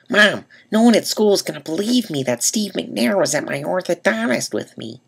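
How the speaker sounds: squeaky excited seventh grader; boy pleading with his mom about school friends; seventh-grade self. Retold or imitated. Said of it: "I actually did talk like that in seventh grade".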